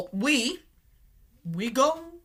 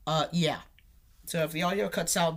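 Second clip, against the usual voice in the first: usual speaking voice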